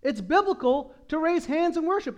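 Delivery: normal talking voice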